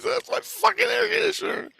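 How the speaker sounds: very high, screechy protest; hammed-up furious yell; mock outraged shout. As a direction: shout it as an outraged housemate, pitch way up. Housemate's side of acted quarrel.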